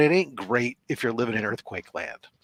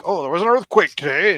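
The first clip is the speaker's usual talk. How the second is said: higher, louder dramatic newscaster; mock news reporter; 7 semitones higher, 5 dB louder than usual. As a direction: hype it like a breaking story. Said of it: "they get all like".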